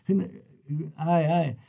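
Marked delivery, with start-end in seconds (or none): none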